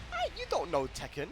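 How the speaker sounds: ordinary talk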